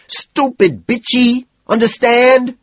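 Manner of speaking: very loud, high, slow shout; a red-faced, furious shout. A narrator's reading. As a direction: scream it at her near tears. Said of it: "I shout".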